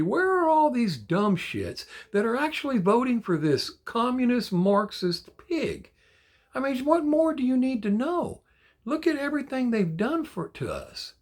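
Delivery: pitched high, incredulous and fed up; rising incredulous outrage; exasperated rant. Real, not acted. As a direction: demand an answer in disbelief. Political rant, baffled anger.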